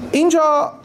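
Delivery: ordinary delivery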